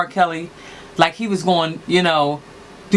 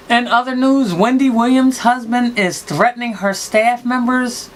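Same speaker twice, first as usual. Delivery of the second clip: news reader; 4 semitones higher than usual. Acted. Announcing next gossip story.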